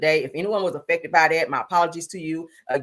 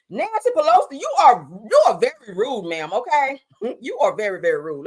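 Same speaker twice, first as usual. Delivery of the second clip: mock scolding; 5 semitones higher, 5 dB louder than usual. Scolding Pelosi over the clip.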